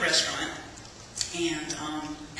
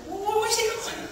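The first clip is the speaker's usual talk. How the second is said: much higher, laughing disbelief; baffled, half laughing; surprised. Retold or imitated. Watching Henry hurry off, startled and laughing. He darts away with the food.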